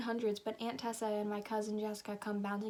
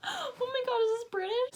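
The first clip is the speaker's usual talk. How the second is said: very high, loud squeal of disbelief; shocked squeal; 6 dB louder than usual. Real, not acted. Reader reacting to her own British character, shocked squeal. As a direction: squeal it far up at the top of the voice.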